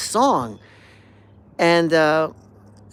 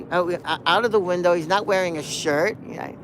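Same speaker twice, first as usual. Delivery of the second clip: usual speaking voice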